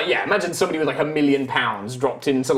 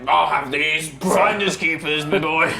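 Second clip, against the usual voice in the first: gloating barbarian; 2 semitones higher, slower than usual. Acted.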